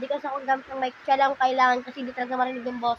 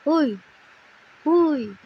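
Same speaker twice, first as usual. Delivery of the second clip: playful calls; 3 semitones higher, 6 dB louder, much slower than usual. Real, not acted. Calling baby playfully.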